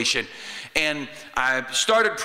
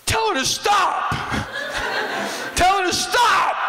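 frantic shout. Acted. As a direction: yell it desperately, a hint of laughter. Lazarus begs from heaven.

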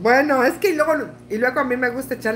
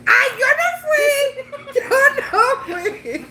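much higher squealing denial through laughter; caught denying, laughing; 11 semitones higher than usual. Retold or imitated. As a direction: squeal it out like a guilty kid, laughing. (negación chillona entre risas, mucho más aguda)

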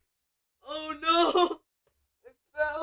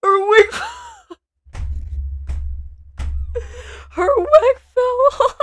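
laughing; 13 semitones higher than usual. Real, not acted. Laughing at a clip.

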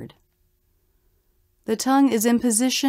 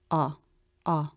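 "Ah" very short, low and flat; unstressed AH; 5 semitones lower, 6 dB quieter than usual. Shown as a demonstration. Teacher showing unstressed syllables aren't as long, clipped low unstressed "ah". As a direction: drop each "ah" quickly, no stress. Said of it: "Unstressed syllables won't be as long".

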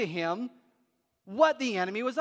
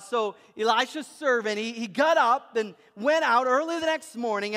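ordinary talk, nothing marked

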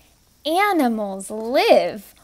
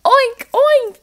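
high, loud playful oink, oink; teacher voicing the pig for kids; 11 semitones higher, 6 dB louder than usual. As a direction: squeal it like a piglet. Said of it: "says the pig".

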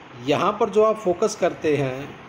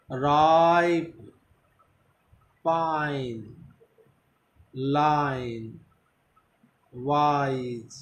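British "पाइप", "लाइन", slow, with a stressed आ; British English "राइप", its आ drawn out; much slower than usual. Shown as a demonstration. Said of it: "आ पर stress रखना है". (British उच्चारण में "पाइप", आ खींचकर)

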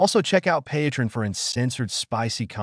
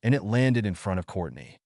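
ordinary delivery

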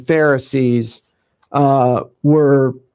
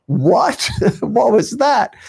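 mock baffled; 6 semitones higher, slower than usual. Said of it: "would look at each other like".

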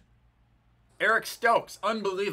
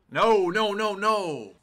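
alarmed protest; 5 semitones higher, 4 dB louder, much slower than usual. Real, not acted. Bad play happening in game.

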